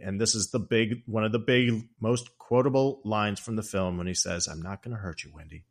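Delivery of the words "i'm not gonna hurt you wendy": much softer, lower, crooning threat; husband soothing his wife with hidden menace, very soft; creepy soft menace. Retold or imitated. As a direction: lull her with a quiet, sinister promise. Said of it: "He's doing different voices".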